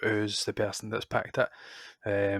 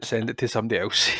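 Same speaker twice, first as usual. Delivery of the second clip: usual speaking voice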